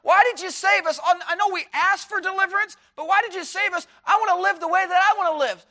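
high, loud, petulant whine; whiny complaining child. Pretend. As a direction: whine it like a spoiled kid.

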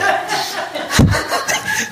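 high burst of laughter. Real, not acted. Laughing at own joke.

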